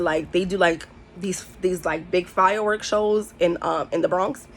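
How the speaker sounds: ordinary delivery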